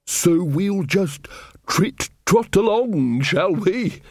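muffled sing-song taunt, as if under a seat cushion; slow mock trit-trot tease, muffled; slightly muffled. A narrator's reading. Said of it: "his voice by now slightly muffled".

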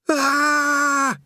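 a sudden high yelp of fright; panicked yelp. Acted. Rincewind plummeting, letting out a high yelp. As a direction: yelp in terror as you drop. Rincewind falling through the sky.

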